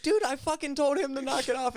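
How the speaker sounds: high-pitched, indignant mock protest; acted-out exasperation; exasperated retelling. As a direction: re-enact your fed-up complaint, voice way up. Retold exasperated protest to friend.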